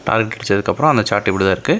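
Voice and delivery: ordinary talk, nothing marked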